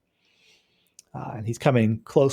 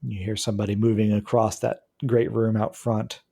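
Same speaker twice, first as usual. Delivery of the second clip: ordinary talk, nothing marked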